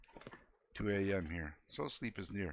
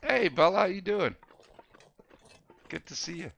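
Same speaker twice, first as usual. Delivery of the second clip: excited greeting; 8 semitones higher, 6 dB louder than usual. Real, not acted. High greeting to raider.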